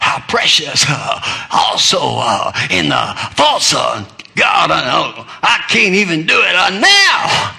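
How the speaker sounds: mock old-time preacher, gasping hacks between phrases; hammed-up hacking sermon shout. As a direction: hack and shout each phrase like a fiery preacher. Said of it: "that hacking voice".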